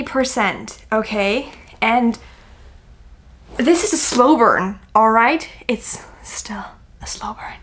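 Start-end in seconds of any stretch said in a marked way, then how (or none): 5.7-7.6 whispering. Real, not acted. Whispered aside on slow burn.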